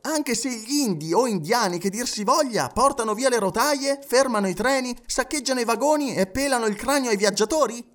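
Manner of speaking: high, insistent run of alarming what-ifs; agitated objector. Acted. (obiezione incalzante)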